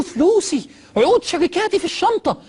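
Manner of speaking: high, loud, strained cry for his money; frantic dying man. Retold or imitated. Dying businessman on the plane, panicked high cry for his bag.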